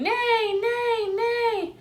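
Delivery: very high squeaky neighs; little chicks shouting neigh. A narrator's reading. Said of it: "ran around shouting".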